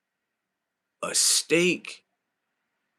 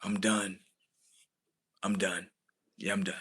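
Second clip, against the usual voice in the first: quiet defeated mutter; resigned; 7 semitones lower, 8 dB quieter than usual. Real, not acted. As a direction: lower and softer, trailing off. Giving up on the date.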